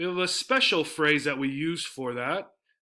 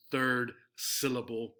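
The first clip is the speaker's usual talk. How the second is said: ordinary delivery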